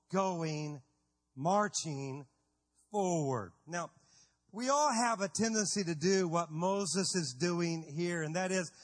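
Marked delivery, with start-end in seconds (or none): none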